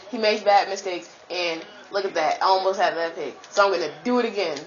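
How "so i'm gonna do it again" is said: ordinary talk, nothing marked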